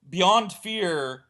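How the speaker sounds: normal talking voice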